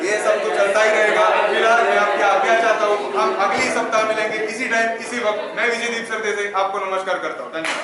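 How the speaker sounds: loud, booming TV anchor farewell; news anchor sign-off. Acted. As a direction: close the show like a TV host. Anchor signs off the show. (जोरदार TV anchor अंदाज़)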